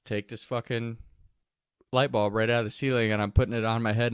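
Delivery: ordinary talk, nothing marked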